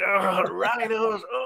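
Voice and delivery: slow, drawn-out daze; slow stunned groan; exploding head moan. Pretend. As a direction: moan it out slowly like your head is bursting. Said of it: "His head's exploding, going".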